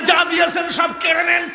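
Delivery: high, shouted plea in a story; acted outcry. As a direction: cry it out as the devotee. Acts devotee's defiant reply in story. (উঁচু গলায় চিৎকার করে)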